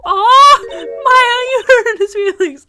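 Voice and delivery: mock dismay. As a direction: wail it in hammed-up shock. Acted dismay at detective.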